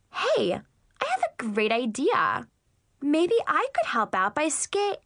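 high gushing phony voice; fake-sweet mean girl.

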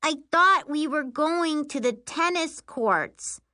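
high, drawn-out whine; slow, high-pitched griping; whining. Acted. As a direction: complain like a put-out kid sister. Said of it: "Miri whines".

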